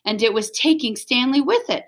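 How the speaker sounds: plain reading voice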